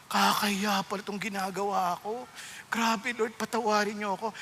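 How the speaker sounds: soft, low plea to God; repentant prayer. Retold or imitated. Reader confessing to the Lord over the word, softly repentant. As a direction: pray it quietly, humbled.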